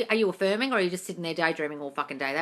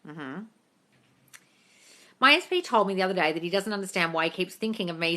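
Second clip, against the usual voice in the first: usual speaking voice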